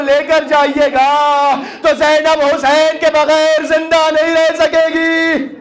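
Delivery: impassioned lamenting plea. Acted. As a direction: plead as Zainab, rising to a long wail. Zainab's lamenting speech, preached.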